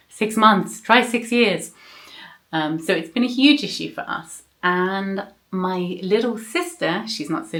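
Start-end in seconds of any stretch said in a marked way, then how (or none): 0.1-1.7 higher, louder incredulous comeback; exasperated one-upping, pitched up and louder; incredulous retort. Retold or imitated. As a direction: throw it back in mock disbelief. Mock retort to friends' complaint.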